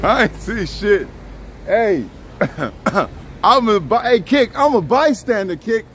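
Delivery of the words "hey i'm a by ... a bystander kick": usual speaking voice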